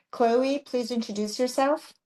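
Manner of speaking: usual speaking voice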